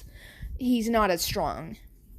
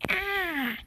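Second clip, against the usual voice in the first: high yelp on falling; a quick startled high cry; small high baby yelp; 9 semitones higher than usual. Retold or imitated. Deku falls, yells.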